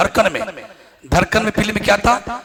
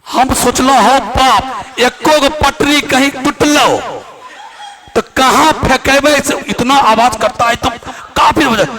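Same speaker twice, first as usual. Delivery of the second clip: loud; 6 semitones higher, 6 dB louder than usual. Retold or imitated. Imitating a rattling night train.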